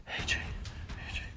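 soft, slow whisper of his name; whispering to his buddy while off balance; whispered call. Real, not acted. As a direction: hush it so the fish won't spook. Calls quietly while slipping.

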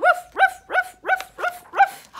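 very high, sharp yapping; dog barks. Pretend. Reader makes dog sounds with the children, high yapping. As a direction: bark high like a little dog.

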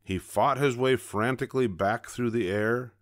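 usual speaking voice

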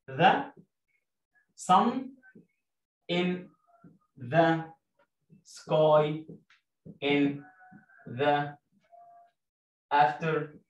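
each word of "The sun in the sky in the afternoon" spaced out; word by word dictation. Shown as a demonstration. Teacher dictating sentence two to pupils, word by word. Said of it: "بيقول لي".

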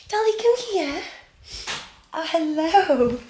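high squeaky dog-greeting voice. Real, not acted. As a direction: coo at the puppy, way up high. Calling and greeting her dog.

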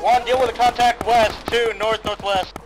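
shouted command. Real, not acted. Leader calling contacts.

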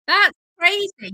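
high, loud amazed exclamation; surprised. Real, not acted. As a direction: blurt it out in disbelief. Reacts to snow in spring.

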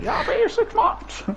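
high, slow reply in a put-on Chinese accent; mock Chinese accent. [name] answering a friend in the shop, mock Chinese accent. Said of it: "He fucking develops it".

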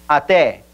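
ATÉ as "ah-TEH"; open stressed e. Shown as a demonstration. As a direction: stress the last vowel of ATÉ, keeping it open. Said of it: "esa E es abierta porque está acentuado".

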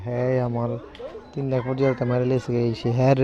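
ordinary talk

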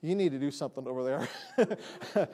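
low, soft, amused aside; hushed, lightly comic voice of God; imitated God's aside. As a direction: drop low and quiet, indulgent, with a laugh behind it. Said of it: "and he says".

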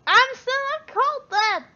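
put-on squeaky high child voice; high kid voice. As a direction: pitch it way up like a kid. Kid character protests being called Charlie.

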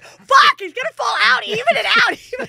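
high, loud shouting through laughter; hammed-up panicked yelling, laughing. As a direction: yell it like the stretcher might tip. Acted panicked rescuer shouting.

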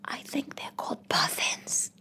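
soft, slow whispered aside; whispering. Retold or imitated. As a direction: whisper it so she can't hear. Quiet correction to stranger.

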